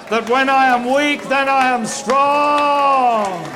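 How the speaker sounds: high, loud, very slow drawn-out declaration; climactic preaching shout. Real, not acted. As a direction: stretch it out to a ringing climax.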